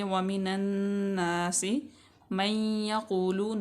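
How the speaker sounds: النَّاسِ with a held nasal ghunna on the doubled noon; nasal ghunna shown. Shown as a demonstration. Teacher shows the ghunna to her students, held nasal noon. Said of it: "गुन्ना हम करेंगे".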